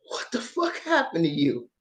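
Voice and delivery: low, soft disbelief; imitated hushed bewilderment; retold stunned question. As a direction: ask it quietly, as if shaken. Corey's shaken question, retold.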